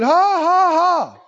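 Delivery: loud put-on "ha, ha, ha"; mock forced laugh. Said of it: "He said".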